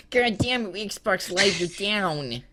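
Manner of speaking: lightly nasal angry gamer; a hint of a whiny nasal gamer rant; nasally Francis voice. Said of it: "he has, like, the nasally voice".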